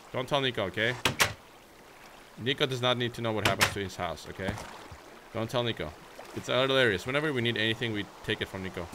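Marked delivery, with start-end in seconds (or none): none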